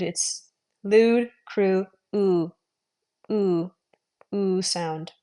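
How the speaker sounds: "lewd" with a simple oo, no glide; demonstrating the unfancy oo; plain "oo". Shown as a demonstration. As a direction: keep "oo" plain, not fancy. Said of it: "Don't get too fancy".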